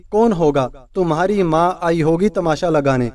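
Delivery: ordinary delivery, nothing marked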